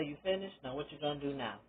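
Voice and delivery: put-on quietly deadpan, not babying her; blunt therapist, soft. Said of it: "she say".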